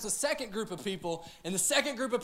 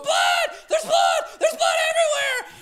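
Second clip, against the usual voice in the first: much higher, loud frantic screaming; panicked shriek; 8 dB louder than usual. Retold or imitated. Wife freaking out over baby's IV blood, shrieking. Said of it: "She's going".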